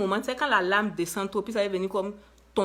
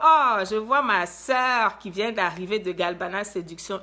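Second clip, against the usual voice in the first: real excited welcome, louder than usual; delighted greeting; 7 dB louder than usual. Real, not acted. Host spots a sister arriving at the talk, delighted greeting. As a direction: call out the new arrival with delight.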